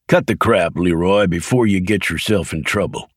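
usual speaking voice

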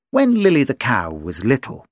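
usual speaking voice

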